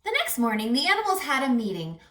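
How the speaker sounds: usual speaking voice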